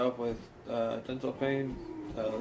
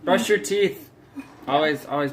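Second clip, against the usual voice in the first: loud urging; 4 semitones higher, 11 dB louder than usual. Real, not acted. Urgent advice to viewers.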